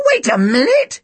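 high, squawky exclamation; high parrot-like boy's outcry; high-pitched cry. A narrator's reading. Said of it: "he cried in a high-pitched voice".

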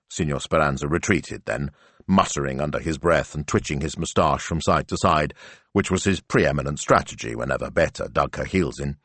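ordinary delivery, nothing marked

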